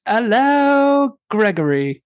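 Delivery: high, drawn-out British hello; hammed-up British greeting, starting high; mock British accent. Host greeting Gregory back, mock British.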